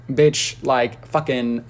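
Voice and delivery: ordinary delivery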